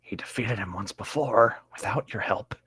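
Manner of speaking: soft unvoiced whisper. Real, not acted. Co-host whispering a snarky aside to the reader, hushed whisper. Side comment under breath.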